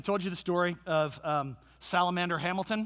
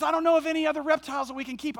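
put-on pleading girl; 9 semitones higher, 5 dB louder, faster than usual. Daughter pleading for reptile.

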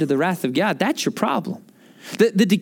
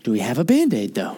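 a hint of a coaxing little boy; wheedling kid; 4 semitones lower than usual. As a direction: sweetly wheedle for the Band-Aid. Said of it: "he's like".

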